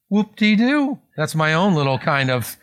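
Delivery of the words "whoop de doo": mock-dismissive, pitched up high; Pharaoh's sarcastic brush-off. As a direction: toss it off as a sarcastic, higher-pitched jeer. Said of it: "The sarcasm".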